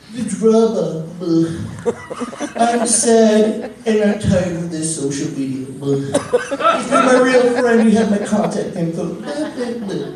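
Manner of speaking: whiny Facebook quitter. Retold or imitated. Mocking people who announce they're leaving Facebook, whiny and loud. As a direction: ham up the dramatic goodbye post. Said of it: "They're like".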